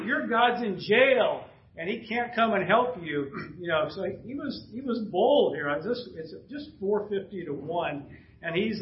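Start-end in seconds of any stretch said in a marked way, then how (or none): 0.0-3.3 higher, slow, mocking jeer; taunting prophets whose god is in jail. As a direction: poke fun at the stuck god. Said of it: "Elijah's actually poking at that".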